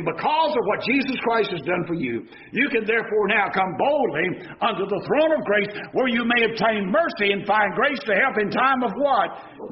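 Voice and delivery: ordinary talk, nothing marked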